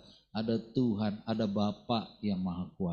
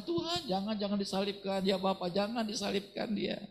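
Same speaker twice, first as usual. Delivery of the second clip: pleading disciple; 8 semitones higher than usual. Pretend. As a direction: beg him not to go to the cross. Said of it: "Kalau kita sayang".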